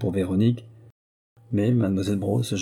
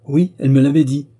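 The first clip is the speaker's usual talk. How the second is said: loud, gruff retort at a higher pitch; 6 semitones higher, 10 dB louder than usual. Acted. Sister answering the prince, gruffly and loud. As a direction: snap the answer back gruffly. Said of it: "d'un ton bourreux".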